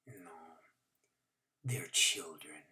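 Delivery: put-on low, slow whispered old man; Doctor Korchak whispering to the boy, low and tender. A narrator's reading. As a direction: breathe it out gently, like a father.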